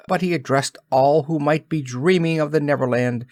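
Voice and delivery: usual speaking voice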